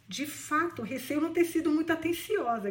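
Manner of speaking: plain reading voice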